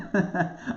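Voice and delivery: quick amused chuckle; real chuckle at one's own joke; short laugh. Real, not acted.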